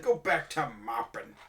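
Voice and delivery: slow haughty order; bossy captain. Retold or imitated. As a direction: talk down to a deckhand. Mimicking snobby captain.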